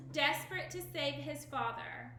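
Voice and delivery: usual speaking voice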